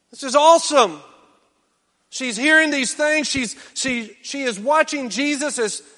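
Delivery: excited preaching. Real, not acted. Preacher tells the congregation about the woman, excited preaching. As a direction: lift the room with joy.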